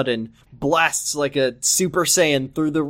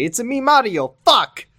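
much higher cartoon plumber yelp; Mario impression; 8 semitones higher than usual. Uncle swearing in a game, high cartoon Mario voice. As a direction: belt it like a video-game Mario. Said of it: "his uncle would say".

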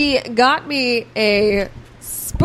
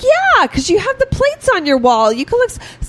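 much higher, eager mom; mom's voice; 11 semitones higher than usual. Retold or imitated. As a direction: imitate the mom's upbeat logic. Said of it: "she's like".

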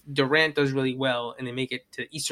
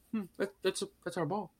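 softer, puzzled muttering before the yell; quiet musing; 3 semitones higher, 10 dB quieter than usual. Retold or imitated. LeBron noticing the ball, quiet puzzled imitation. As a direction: mull it over under your breath. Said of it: "he's yelling".

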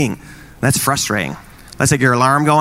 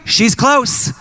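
mock excitement; 11 semitones higher, slower than usual. Kids excited mom is home.

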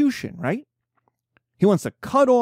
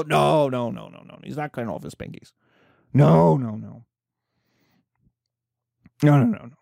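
crowd saying no; 5 semitones lower than usual. Acted. Crowd voiced rejecting the punishment.

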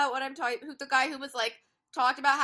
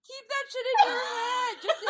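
exasperated yell; 12 semitones higher than usual. Real, not acted. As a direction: scold him, half laughing. Outraged rant at a character.

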